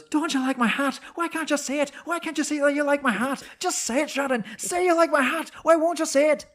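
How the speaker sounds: retelling a film scene, a mock Cruise nagging Shannon about his hat; Tom Cruise impression. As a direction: plead for praise of your hat in a hammed-up Cruise voice. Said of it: "And he goes".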